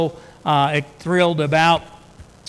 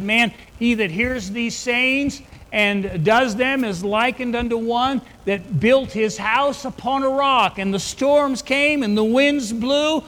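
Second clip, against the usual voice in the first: full-force preaching; 6 semitones higher than usual. Acted. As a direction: build the parable up to a climax.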